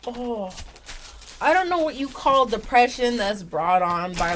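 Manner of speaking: ordinary talk, nothing marked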